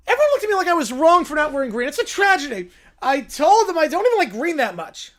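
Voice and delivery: loud, fast whiny complaint; mock outrage. To the listeners, a whiny kid complaining about green.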